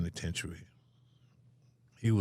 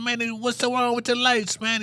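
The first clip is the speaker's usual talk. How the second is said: high, loud complaint; retold yell to the next cell; indignant shout; 5 dB louder than usual. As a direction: shout it through the bars. Shouting to neighbors about the lights.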